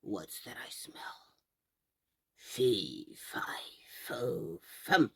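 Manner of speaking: very low whispered ogre chant; menacing ogre. Acted. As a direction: growl it low like a sniffing giant. Ogre sniffing, fee fi fo.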